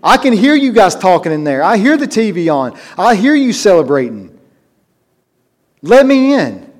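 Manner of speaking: high, loud pleading at a locked door; desperate screaming plea. Pretend. Sinner shut out, pounding and crying at heaven's door. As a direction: beg to be let in, crying out. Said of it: "standing there screaming and crying".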